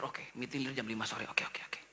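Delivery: unvoiced whisper to himself; whispered thought. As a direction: whisper it like a private reminder. Pak Udin thinking to himself. (berbisik pelan)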